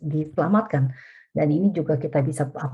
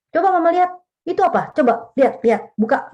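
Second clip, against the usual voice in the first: much higher, louder, short and sharp orders; sharp interrogation; 12 semitones higher, 4 dB louder than usual. Shown as a demonstration. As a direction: demand to see the screen like a suspicious mother. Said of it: "nadanya juga tinggi, terus pendek, tajam". (ibu curiga memeriksa anak, nada tinggi, pendek, tajam)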